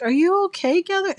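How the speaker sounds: high, loud mock alarm; worried seller. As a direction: imitate someone urgently checking on a stranger. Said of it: "she is worried about me".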